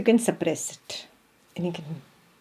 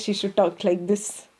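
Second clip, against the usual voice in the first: subdued, composed mock-holy voice; restrained and even, pretending to be holy; put-on holy speech. Shown as a demonstration. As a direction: demonstrate how a saintly person supposedly talks. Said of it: "Ekta should not talk like this".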